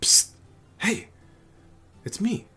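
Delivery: high loud stage-whisper call; stranger's voice. Acted. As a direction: hiss then call out high. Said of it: "stranger's voice go".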